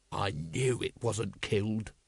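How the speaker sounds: growled low and quiet. Acted. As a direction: growl it under your breath. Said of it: "growled Vimes".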